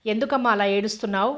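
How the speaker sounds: ordinary delivery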